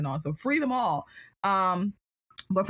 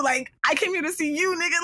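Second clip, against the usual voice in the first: exasperated; 9 semitones higher than usual. Retold or imitated. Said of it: "I was freaking out".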